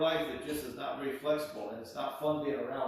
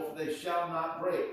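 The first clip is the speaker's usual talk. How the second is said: ordinary talk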